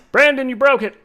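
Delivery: loud, high playful blame; mock accusation. Streamer playfully blaming a viewer for breakage, loud mock accusation. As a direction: call out the culprit in mock outrage.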